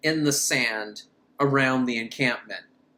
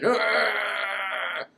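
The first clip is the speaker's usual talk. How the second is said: mock scream; 3 semitones higher than usual. Ahmed screaming in the film.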